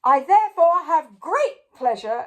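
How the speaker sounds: high, loud pompous king; king proclaiming the winner; royal announcement. A narrator's reading. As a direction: declare it grandly. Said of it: "announced the king".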